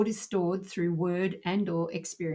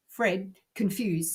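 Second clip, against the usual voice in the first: ordinary delivery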